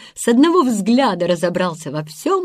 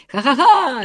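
higher acted burst of laughter; guffawing; 6 semitones higher than usual. A narrator's reading. The other boys burst out laughing, guffaw. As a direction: join in with a hearty ha-ha-ha. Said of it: "расхохотались остальные".

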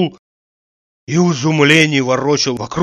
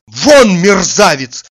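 loud, explosive order to get out; enraged shout; 4 semitones higher, 8 dB louder, slower than usual. A narrator's reading. Oblomov throwing out his insulter, furious loud shout. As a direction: thunder it in fury. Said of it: "закричал Обломов".

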